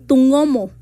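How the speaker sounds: tungomo with the velar ng sound; demonstrating tungomo; Zoque word. Shown as a demonstration. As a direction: say tungomo with the ng from the back.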